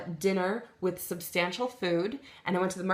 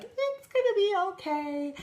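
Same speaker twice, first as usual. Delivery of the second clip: much higher soothing mom voice; imitating mom; 12 semitones higher than usual. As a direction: soothe her like a mother, up high. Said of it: "she was like".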